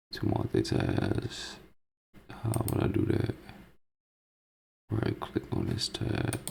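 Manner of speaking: very soft, mostly voiceless mumble; muttering to himself. Real, not acted. Vlogger working out his computer tab, muttering to himself. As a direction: think aloud under your breath.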